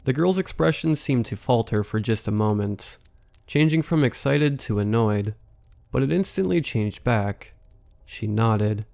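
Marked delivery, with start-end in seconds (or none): none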